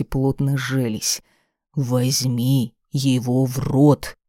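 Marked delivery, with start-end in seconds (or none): 1.7-4.2 slow, hard order allowing no argument; rough command. Acted. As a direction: bear down on every word. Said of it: "Приказ грубый, не терпящий возражений".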